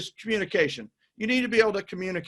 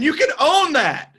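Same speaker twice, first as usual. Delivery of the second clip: higher, louder and slower, pumping him up; hyped encouragement; 7 semitones higher, 5 dB louder than usual. Real, not acted. Host hyping up his guest, excited loud encouragement. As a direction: sell him on his own look.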